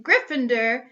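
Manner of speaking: loud, falling shout of the house name; hat shouting. Acted. The Sorting Hat finally calls Neville's house, shouting. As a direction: proclaim it to the hall at last. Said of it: "When it finally shouted".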